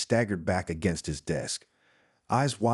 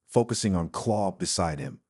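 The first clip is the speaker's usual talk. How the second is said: ordinary delivery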